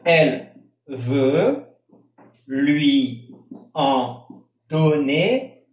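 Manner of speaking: elle veut lui en donner spaced out slowly; slow demo. Shown as a demonstration. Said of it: "Con doble pronunciación". (dictado lento de elle veut lui en donner)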